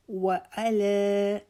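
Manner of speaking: وعلی chanted very slowly; slow melodic recitation; tajweed recitation. Shown as a demonstration. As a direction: stretch وعلی like a recited prayer. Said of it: "لا کو چار انگلیاں کھول کے لمبا کریں گے".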